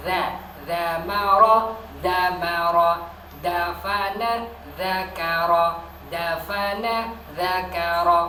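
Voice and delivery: dhamara and dzakara said loud, heavy dha against da; loud pronunciation drill. Shown as a demonstration. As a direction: drill the pairs loudly. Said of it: "Dha sama da". (latihan dha dan da, keras)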